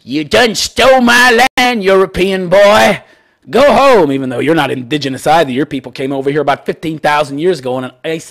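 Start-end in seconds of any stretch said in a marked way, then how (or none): 0.0-4.1 slow, drawn-out mock protester voice, higher and louder; hammed-up imitation of the angry drummer; angry Native elder. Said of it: "He was angry".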